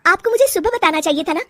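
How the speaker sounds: high, quick, indignant scolding; irritated young wife answering back; annoyed complaint. Acted. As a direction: tell her off, pitched high and fast. Wife scolds mother-in-law over guests.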